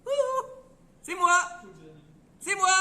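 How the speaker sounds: high, loud little voice calling from afar; small far-off voice. A narrator's reading. Tiny soul calling out from the distance to God, high and loud. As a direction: call out thin and high from far away. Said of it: "une petite voix un peu au loin qui dit".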